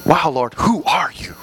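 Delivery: soft, low wonder at the Lord; hushed awe with a small laugh; awed wonder. Retold or imitated. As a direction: marvel quietly, like a boy discovering him.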